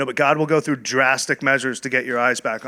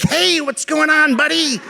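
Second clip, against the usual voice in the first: much higher, slow, over-bright greeting with a laugh; cheerful greeter; 6 dB louder than usual. Retold or imitated. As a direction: ham up a jolly welcome from Andy. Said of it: "and he was like".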